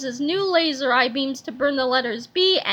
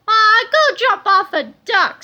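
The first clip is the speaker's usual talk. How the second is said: higher, loud defiant taunt; 6 semitones higher, 8 dB louder than usual. A narrator's reading. Captain Underpants taunting Melvin, defiant and loud. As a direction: brush him off boldly.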